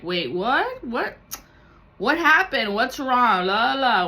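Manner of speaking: high, loud feigned confusion; mock-innocent friend. Friend brushing off hurt feelings, mock innocent. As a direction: play dumb with a singsong dodge. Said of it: "And like".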